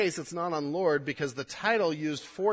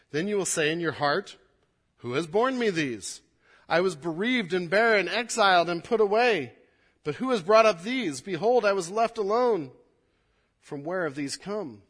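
solemn reading that swells louder; scripture reading; 3 semitones higher, 5 dB louder than usual. Acted. Pastor reads Isaiah to the congregation, swelling in force. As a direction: proclaim the verses with rising force.

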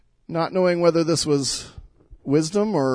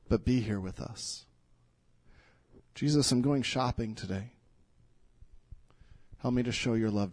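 soft, lower, personal prayer to Jesus; intimate prayer; 4 semitones lower, 4 dB quieter than usual. Acted. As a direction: pray quietly as if alone with God. Pastor voices sample everyday prayers.

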